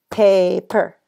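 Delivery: "Paper" drawn out loudly as PAY-per; split into syllables. Shown as a demonstration. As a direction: stretch "Paper" into two clear beats. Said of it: "break a word into syllables".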